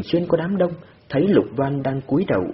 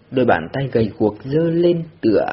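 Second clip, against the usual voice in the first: ordinary delivery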